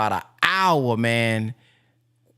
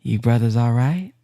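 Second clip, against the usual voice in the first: gentle minister; 7 semitones lower than usual. Retold or imitated. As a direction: coo like a kind minister checking on his flock. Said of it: "And then the minister said".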